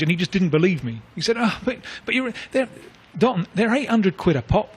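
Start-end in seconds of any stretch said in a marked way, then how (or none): 1.4-4.8 mildly incredulous retelling, higher pitched; mock disbelief at the price. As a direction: retell his baffled protest, voice lifting.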